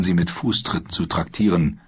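ordinary delivery

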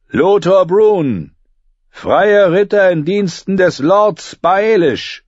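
high, slow ringing herald's call; royal herald. A narrator's reading. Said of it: "rief der Herold".

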